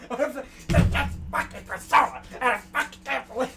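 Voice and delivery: slow, loud sputtering rant; high, exploding comic outrage. Acted. Player going into comedian mode, exasperated rant.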